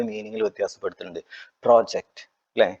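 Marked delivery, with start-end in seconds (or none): 1.6-2.3 project with a louder first syllable pro; stress on first syllable. Shown as a demonstration. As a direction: say the noun project, hitting pro. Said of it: "noun ആണ്".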